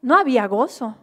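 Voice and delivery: loud, impassioned preacher declaring; preaching at full force. Acted. Pastor to congregation, preaching loudly. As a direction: proclaim it to the congregation.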